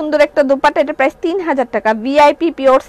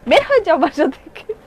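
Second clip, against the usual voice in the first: mock brother ordering out. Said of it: "ওরা আমার সাথে কি অত্যাচার করে".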